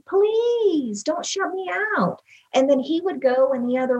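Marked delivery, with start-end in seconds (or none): 0.0-2.2 much higher, slowing into a begging plea; wife retelling her plea to her withdrawn husband, desperate; frustrated pleading. Retold or imitated. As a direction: press him, then slow right down on please don't shut me out. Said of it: "I was full of anger".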